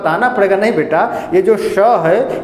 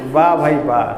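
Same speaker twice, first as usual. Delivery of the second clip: mock praise; 6 semitones lower than usual. Teacher mocks student's answer.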